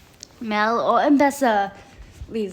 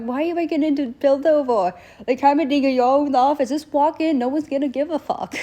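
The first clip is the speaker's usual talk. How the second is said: much higher, mock exasperated; 6 semitones higher, faster than usual. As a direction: scold them, high and incredulous. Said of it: "I'm like".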